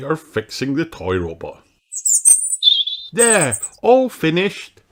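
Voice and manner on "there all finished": put-on high, slow satisfied boy voice; a little boy proud of his work; pleased elf boy. A narrator's reading. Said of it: "says Ben".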